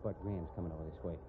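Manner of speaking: soft, quick hushed warning. Acted. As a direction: hush them before he hears. Warning hush as sheriff approaches.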